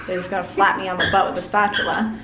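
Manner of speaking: ordinary talk, nothing marked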